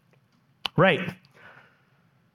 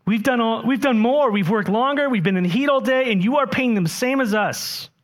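frustrated workers; 6 semitones higher than usual. Acted. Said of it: "Are you tracking with their frustration?".

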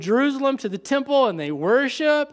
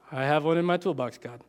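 dopey mock Amos; 6 semitones lower, 5 dB quieter, faster than usual. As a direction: play Amos as a clueless guy guessing. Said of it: "That, that's how he said it".

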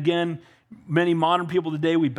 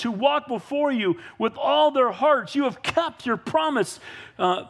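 higher, fervent prayer voice; preached prayer; 6 semitones higher than usual. Acted. Solomon kneeling before the people, preaching his prayer. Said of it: "he says".

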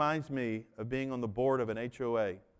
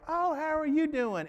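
sweet phone greeting; 11 semitones higher than usual. Retold or imitated. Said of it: "she was like".

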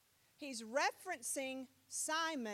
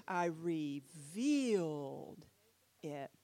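slow drawn-out reveal; 7 semitones lower, much slower than usual. Shown as a demonstration. Showing the word revealed.

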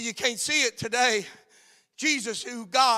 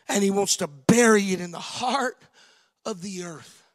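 ordinary talk, nothing marked

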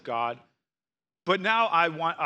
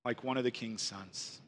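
repeated much softer and lower, savoring it; quiet echo; 5 semitones lower, 11 dB quieter than usual. Shown as a demonstration. Pastor echoing the verse to congregation, hushed and reflective.

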